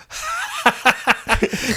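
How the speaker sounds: high, forced instructor guffaw; imitated big laugh in the recruit's face; mocking laugh. Instructor laughing at a deluded recruit, mocking.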